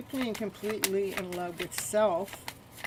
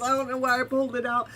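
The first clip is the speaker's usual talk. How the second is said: louder and fast, still buzzing; fast excited aside; excited disbelief; 4 semitones higher, 6 dB louder, much faster than usual. Real, not acted. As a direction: rattle it off in thrilled disbelief. Empress card reappears.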